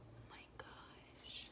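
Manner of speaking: whispered amazement; hushed wow; awed whisper. Real, not acted. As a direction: breathe it out in awe. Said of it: "whispered amazement".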